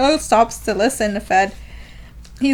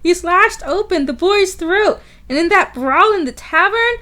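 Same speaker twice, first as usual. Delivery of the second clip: high, theatrical gossip voice; gossiping townsperson; 7 semitones higher than usual. Acted. Townsperson gossiping about Tonio, theatrically scandalised. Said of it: "got direct quote of the gossip".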